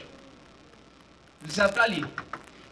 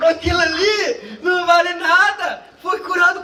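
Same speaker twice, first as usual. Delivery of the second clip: louder, higher, laughing through the complaint; laughing mock outrage; 4 semitones higher, 4 dB louder than usual. Preacher repeating the jealous churchgoer's complaint, laughing loud outrage. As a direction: spit it out laughing in disbelief.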